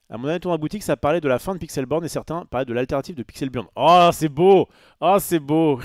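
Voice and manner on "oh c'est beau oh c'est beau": exclamation forte et nettement plus aiguë; s'extasier à pleine voix, deux fois; enthousiaste. Real, not acted. S'extasie sur un nom de logiciel. (cri d'émerveillement sincère)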